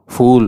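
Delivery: ఫూల్ with a clear English f; correcting her, ఫూల్ with f; correct f sound. Shown as a demonstration. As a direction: say ఫూల్ as fool, not pool. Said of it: "అది పూల్ కాదే".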